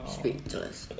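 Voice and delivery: mock whisper. Mocking echo of friend.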